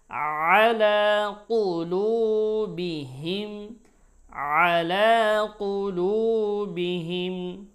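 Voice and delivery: loud measured chant with drawn-out vowels; Quran recitation. Shown as a demonstration. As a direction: recite the verse as a teacher, every letter full and sustained. Recites verse for learners. (জোরে টেনে টেনে তিলাওয়াত)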